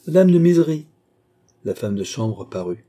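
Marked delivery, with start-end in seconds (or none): 0.1-0.9 loud, high summons to a servant; calling out. A narrator's reading. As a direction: call the chambermaid in from next room. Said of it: "La reine appela".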